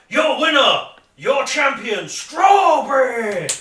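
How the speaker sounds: huge high shout, last word drawn out; ringside announcer. Acted. Player proclaiming Strawberry the champion, triumphant roar. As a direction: bellow it like a fight announcer.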